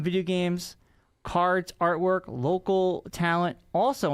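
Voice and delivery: ordinary delivery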